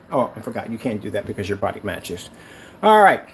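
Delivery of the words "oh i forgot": ordinary talk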